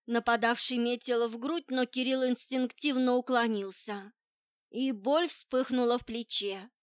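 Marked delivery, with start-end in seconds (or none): none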